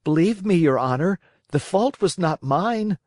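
high, frightened pleading. Acted. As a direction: beg with a high, scared voice. Pinocchio pleads with the director.

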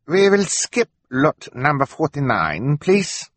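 high, slow, put-on foreign voice; fake broken English. A narrator's reading. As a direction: draw it out like a schemer faking poor English. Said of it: "pretending that he could not speak English properly".

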